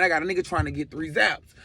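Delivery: plain reading voice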